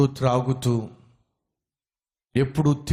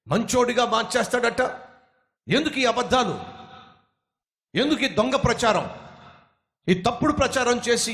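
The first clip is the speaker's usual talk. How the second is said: high, angry yelling at a street preacher; angry shouting; 8 semitones higher than usual. Acted. Angry man shouting at the tract distributor to go away. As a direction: bark threats, higher and hot. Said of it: "గట్టి గట్టిగా అరిచేస్తున్నాడు".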